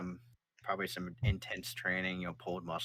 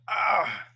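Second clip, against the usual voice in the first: pained yelp; 13 semitones higher, 11 dB louder than usual. Acted. Soldier pushed off.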